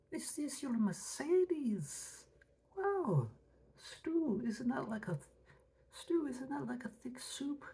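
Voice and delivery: high fussy voice. Acted. Acted quote, high voice.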